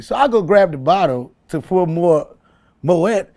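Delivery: ordinary talk, nothing marked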